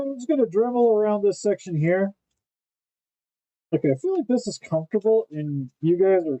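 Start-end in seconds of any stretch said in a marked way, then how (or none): none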